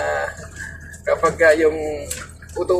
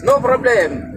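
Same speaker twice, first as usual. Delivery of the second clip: much higher, somewhat louder mock dispatcher; imitating the dispatcher; 6 semitones higher, 3 dB louder, slower than usual. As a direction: play the boss brushing off the complaint. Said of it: "sabi sa akin".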